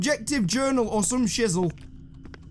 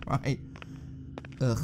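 usual speaking voice

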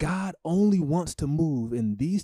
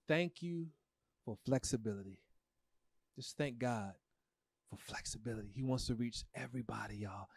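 hushed; 3 semitones lower, 13 dB quieter than usual. Real, not acted. Emotional thanks to God.

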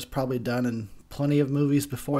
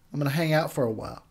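ordinary delivery

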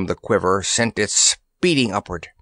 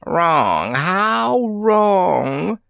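rising to a high, drawn-out cry; chattering headsmen; 8 semitones higher, much slower than usual. A narrator's reading. Flying heads chatter at the intruders, chattering cry. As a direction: chant it high, stretching out the last words. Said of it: "chattered all the headsmen".

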